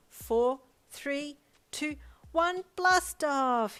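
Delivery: put-on high, loud child scream, slow on blast off; screamed countdown. A narrator's reading. Boy screams the countdown for his dad, slow and loud. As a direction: shout the countdown with glee. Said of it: "Hyoko screamed".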